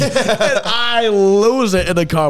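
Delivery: real laughter through the words; slow, laughing it out. Real, not acted. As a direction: crack up as you tell it. Laughing while retelling.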